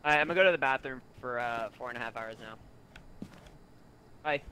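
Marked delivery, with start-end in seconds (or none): none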